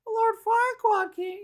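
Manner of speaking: very high, slow squeaky put-on voice; very high cartoon squeak of complaint; fairy tale creatures. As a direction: whimper it like a tiny frightened creature. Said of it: "They're like".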